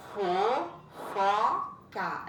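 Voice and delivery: Fofoca drawn out very slowly, syllable by syllable; sounding out syllables. Shown as a demonstration. Teacher sounds out Fofoca slowly for students. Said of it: "uma frase que tá lá separada".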